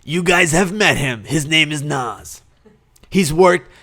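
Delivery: slow, announcer-style intro of Naz; radio host voice. Shown as a demonstration. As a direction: present him like a radio host. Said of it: "Should I do my radio host voice for this?".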